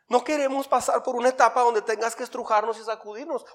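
high-pitched pleading prayer; wife's prayer. Retold or imitated. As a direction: plead with God as his wife would. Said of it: "aprendió a hacer una oración".

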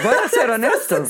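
laughing confession. Real, not acted. Musician admits to his friend he barely studies, laughing. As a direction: confess while still laughing.

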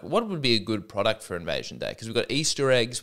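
usual speaking voice

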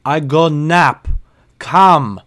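loud, slow, exaggerated broken English; mock customer with exaggerated emphasis; emphatic mock customer. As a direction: spell it out like talking to a fool. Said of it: "he says".

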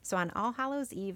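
normal talking voice

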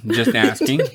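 ordinary talk

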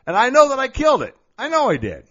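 suddenly high and loud, rushing it out; quick, high-pitched brag; excited boast. Real, not acted. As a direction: burst with pride that you nailed it. Boasting about acing the interview.

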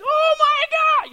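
very high shrieking mock panic; hammed-up panicked scream. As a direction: shriek it like a terrified kid. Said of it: "They're like".

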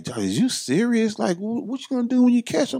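very high-pitched incredulous questioning; imitated girlfriend.

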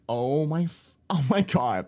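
high, loud gasp of shock; stunned oh my God. Retold or imitated. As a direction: blurt it out in alarm. Reacting to dog jumping out.

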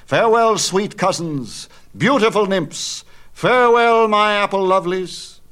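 high, loud joyful call; calling farewell. A narrator's reading. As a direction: shout it across the orchard, exultant. Hero calls farewell to nymphs.

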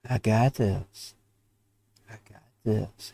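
low, slow and soft, lightly imitated; quietly reassure, as if a voice from above; retelling a calm divine reassurance, slow and soft. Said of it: "he spoke in a calm voice".

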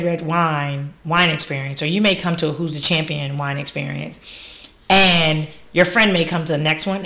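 normal talking voice

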